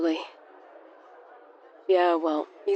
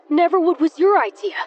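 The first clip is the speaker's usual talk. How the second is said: hissed out; 7 semitones higher, 4 dB louder than usual. A narrator's reading. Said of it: "Eli paused before hissing out".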